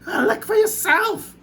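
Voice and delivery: aunt refusing, then seeing the peach grow; astonished aunt. A narrator's reading. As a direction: splutter in disbelief. Aunts amazed.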